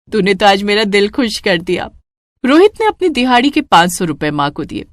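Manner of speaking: usual speaking voice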